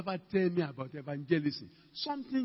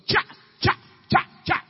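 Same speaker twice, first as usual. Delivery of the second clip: imitated rapid cha sounds; 12 semitones higher, 9 dB louder than usual. Imitating words going out.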